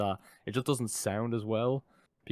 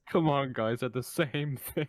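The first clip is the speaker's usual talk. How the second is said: higher, drawn-out protest; teasing protest; 5 semitones higher, slower than usual. Real, not acted. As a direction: plead with them in amused disbelief. Mock protest over confusing two accents.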